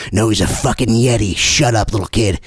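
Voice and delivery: low voice insisting and shutting him down; stubborn believer. Acted. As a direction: brush off the skeptic like a kid. Believer voice snaps back.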